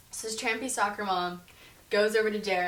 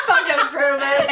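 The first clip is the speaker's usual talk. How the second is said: loud laughing repeat of the line; 5 semitones higher, 12 dB louder than usual. Real, not acted. Retelling the kid's line to a friend, loud laughing. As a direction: crack up while saying it.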